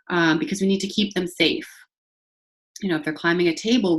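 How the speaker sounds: ordinary talk, nothing marked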